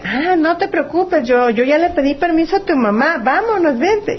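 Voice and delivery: high, mock-sweet coaxing voice, lightly done; sweet-talking stranger. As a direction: reassure her falsely in a high sugary tone. Stranger answers the child, acted scene.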